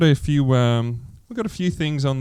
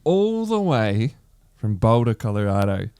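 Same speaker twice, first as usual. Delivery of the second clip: slow, loud, big-build intro; announcer voice; 3 semitones higher, 4 dB louder, slower than usual. Acted. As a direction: hype up the main guest like a ring announcer. Hosts announcing the guest.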